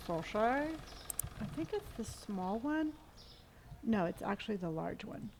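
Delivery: ordinary delivery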